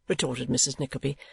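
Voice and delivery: usual speaking voice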